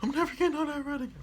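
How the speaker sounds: high, fast rattled vow; mock shaken friend. Friends off the ride vowing never again, high and fast. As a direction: blurt it out in a high rush. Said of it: "They're like".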